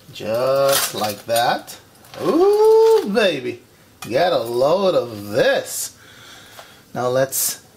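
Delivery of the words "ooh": high delighted ooh. Real, not acted. Delighted food exclamation.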